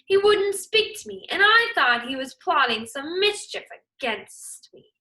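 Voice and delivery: crying out. Acted. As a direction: protest urgently to the king. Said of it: "he cried".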